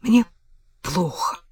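whimpering. Acted. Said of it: "проныла она".

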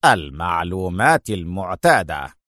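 deep, deliberate villain line; villain's demand. A narrator's reading. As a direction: drop the pitch low and state the demand. Villain states demand, eyes gleaming.

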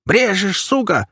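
high-pitched enraged cry; furious, squealing accusation; yell. A narrator's reading. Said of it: "заорал хомяк".

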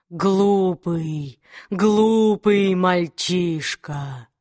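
low, slow hissing demon. Acted. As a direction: hiss it out slowly, contemptuous demon. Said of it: "прошипел Аграмон". (шипящий демон)

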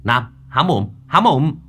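sharp, loud urgent command; shouted order. A narrator's reading. Exorcist shouting at Nam to open his mouth, loud command. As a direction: bark it at him to open up. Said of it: "rồi quát".

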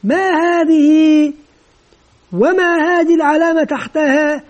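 singsong classroom questioning, held final vowels; teacher quizzing pupils. Acted. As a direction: prompt the class, stretching each question's end. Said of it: "يمكن مثلًا يقول لهم".

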